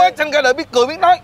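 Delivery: wide-eyed shock at the newborn; amazed. Real, not acted. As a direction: squeal up in astonishment. Amazed a newborn can talk.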